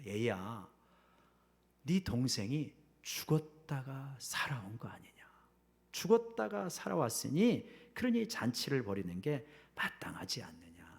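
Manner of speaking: soft whispered pleading of a father; tender whisper. Acted. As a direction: coax him gently in a near whisper. Father gently reasons with elder son. (큰아들을 달래며 속삭이는 아버지)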